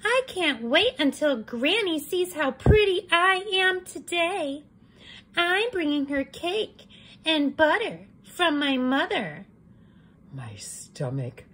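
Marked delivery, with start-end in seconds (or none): 0.0-9.5 put-on preen like a vain little girl. Red admiring her cape, high and sing-song. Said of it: "Little Red said".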